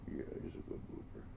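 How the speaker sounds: whispered cooing praise; silly clown. Real, not acted. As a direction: breathe it in a whisper to the cat.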